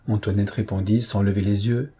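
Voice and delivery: ordinary delivery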